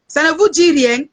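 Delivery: exasperated shouting at the viewers; angry shout. Real, not acted. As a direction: repeat the question as a real yell. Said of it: "Excusez-moi si j'ai crié".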